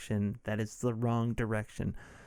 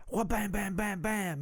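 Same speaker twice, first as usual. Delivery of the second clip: mouthed bam bam gunfire, pitched up; gunshot noises; 8 semitones higher than usual. Retold or imitated. Recapping the movie's shootout, mock gunfire noises. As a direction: spit out the shots like a kid playing guns.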